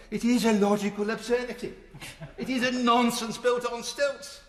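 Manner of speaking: a hammed-up impression of a late parliamentarian, no accent attempted; Enoch Powell impression. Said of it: "as he would have said, and I can't do the Birmingham accent".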